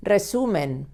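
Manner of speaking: "Resumen" with its first R said as a double R; double R sound. Shown as a demonstration. Teacher showing how a word begins, "Resumen" with a double R. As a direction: roll the R that starts "Resumen". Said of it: "of double R".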